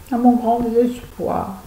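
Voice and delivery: hammed-up sorrow; mock despair. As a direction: lament it slowly, with theatrical despair. Mock despair over an empty serum.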